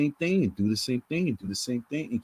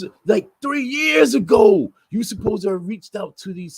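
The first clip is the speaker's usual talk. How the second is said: angry rant; 7 semitones higher, 7 dB louder than usual. Real, not acted. Furious rant at the organiser.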